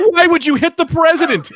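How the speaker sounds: squeaky, high, pleading mock voice; whiny villain. Shang Tsung begging Raiden not to hit him, squeaky and high.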